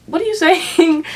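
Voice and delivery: retorting to the teacher, high and laughing; baffled laugh. Retold or imitated. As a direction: laugh it out in disbelief.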